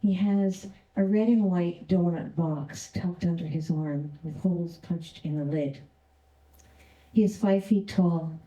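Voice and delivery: ordinary delivery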